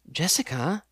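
plain reading voice

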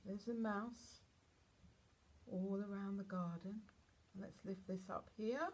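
usual speaking voice

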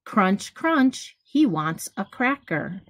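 gentle storybook read-aloud, each rhyme given room; reading to children. Acted. Teacher reading a picture book to kids, lively storytelling. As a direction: read it like bedtime story time for little ones.

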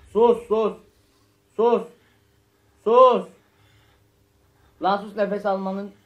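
loud, urgent scared shushing; frantic loud hushing. Real, not acted. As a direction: shush everyone in a panic. Hushing friends while monster searches.

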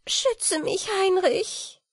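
fairy crying out as the monster comes; in bright terror. Acted. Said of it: "rief Wieglinde in heller Angst".

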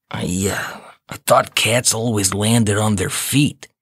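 dazed cat voice. A narrator's reading. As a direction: speak it groggily. Narrator voices Tony.

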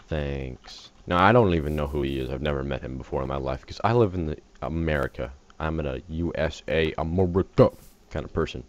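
ordinary talk, nothing marked

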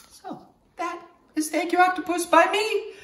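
playful high cartoon sign-off; octopus voice. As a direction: close the book in the octopus voice.

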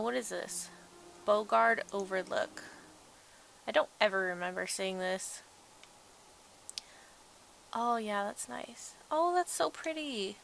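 ordinary talk, nothing marked

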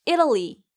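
이탈리 as English "EE-duh-lee"; teacher's repeat of 이탈리, flapped T; stress on first vowel. Shown as a demonstration. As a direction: lean on the first syllable of 이탈리. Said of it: "이에 강세를 줘서".

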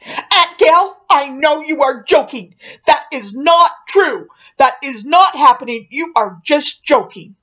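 put-on loud, high-pitched boyish protest; imitated panicked protest. Jason protesting to Aunt Gail that she's joking, panicked. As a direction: punch each word, high and loud, like a panicking boy.

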